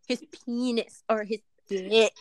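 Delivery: slow, drawn-out stress on "penis" and "dick"; deliberate over-stressing of "penis" and "dick"; exaggerated emphasis. Real, not acted. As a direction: lean hard and slowly on "penis" and "dick". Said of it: "you don't have to put emphasis".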